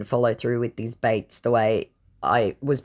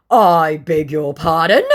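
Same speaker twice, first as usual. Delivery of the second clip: mock affronted; 4 semitones higher, 9 dB louder than usual. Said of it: "he said".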